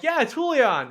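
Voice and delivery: high, loud, casual reply; familiar best friend. Retold or imitated. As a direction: answer like an old buddy would know you. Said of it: "And you're like".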